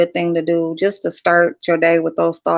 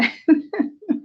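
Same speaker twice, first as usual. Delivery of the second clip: high giggle; 12 semitones higher than usual. Real, not acted. Laughing about son.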